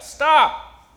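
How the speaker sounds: loud, high, falling command; commanding shout. Acted. Preacher tells the wind off, commanding shout. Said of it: "What would you tell the wind?".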